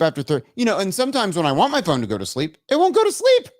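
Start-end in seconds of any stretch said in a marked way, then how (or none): 2.7-3.5 high, loud burst of frustration; exasperated. Real, not acted. Streamer complaining about a contrary phone, exasperated. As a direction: protest loudly, pitch shooting up.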